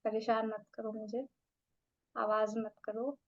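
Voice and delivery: slow, soft, drowsy annoyance; irritated sleepy plea. Shown as a demonstration. Student begging a noisy person to let her sleep, drowsy. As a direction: grumble to be left alone to sleep. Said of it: "irritate हो रहे हो".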